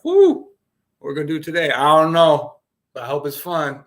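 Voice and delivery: high, loud hammed-up waking-up joy; mock morning cheer. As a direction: burst out of bed cheering. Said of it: "You woke up".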